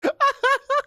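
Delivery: very high squeal of laughter; shrieking laugh. Real, not acted. Cracks up again.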